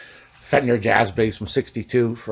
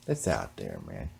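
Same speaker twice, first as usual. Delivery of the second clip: much lower and softer echo; low, quiet repeat; 13 dB quieter than usual. Shown as a demonstration. Repeating the point to himself, low and quiet. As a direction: drop it low, like a muttered aside.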